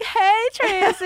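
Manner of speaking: high, loud, drawn-out starstruck squeal; gushing fan greeting. Retold or imitated. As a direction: call out to him like an excited fan. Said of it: "I'm like".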